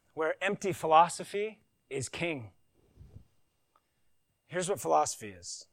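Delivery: ordinary talk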